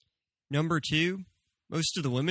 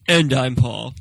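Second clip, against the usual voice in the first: loud intro announce; 10 dB louder, slower than usual. Acted.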